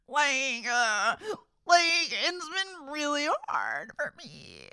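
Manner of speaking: teary high whine, drawn out; imitating someone crying on TV; mock crying. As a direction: whimper it like a weepy reality star. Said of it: "cries and cries".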